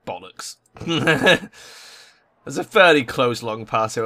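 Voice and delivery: ordinary talk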